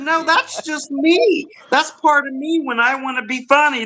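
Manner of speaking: pitched well up, playfully; voice going up. Shown as a demonstration. Guest defending a funny voice to host, voice going up high. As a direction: let the voice climb high to be funny. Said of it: "hear it starts going up".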